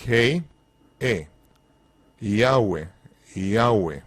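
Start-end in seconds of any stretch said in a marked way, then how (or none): 2.2-4.0 "Yahweh" built from its vowels, ya-a-u-eh; sounded-out name. Shown as a demonstration. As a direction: carefully model "Yahweh" once the vowels are explained. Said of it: "Donde Yod es la Ya".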